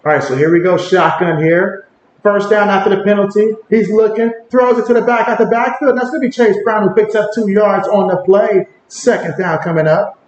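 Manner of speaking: casual live play-by-play, lifting on the action; play-by-play call. Acted. Streamer narrating the game for chat, play-by-play. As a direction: call each snap as it happens.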